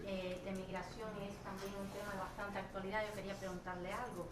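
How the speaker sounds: softly spoken, hard to hear; too soft for the room to hear; quiet voice. Real, not acted. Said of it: "no se oye, no se oye".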